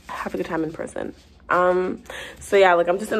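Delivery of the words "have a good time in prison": quiet aside. Acted. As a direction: drop it to an under-the-breath jab. Same send-off repeated under breath.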